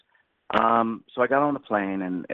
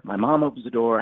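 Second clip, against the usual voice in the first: normal talking voice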